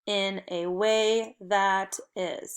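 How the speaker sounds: slow, measured choral reading; word by word. Shown as a demonstration. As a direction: say it slowly so the class can join in. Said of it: "let's do it together".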